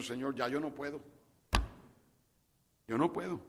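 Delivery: soft, spent plea to the Lord; whisper-close admission of giving up; quiet prayer. Pretend. Believer telling the Lord, quietly, I can't go on. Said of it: "puedas decirle al Señor".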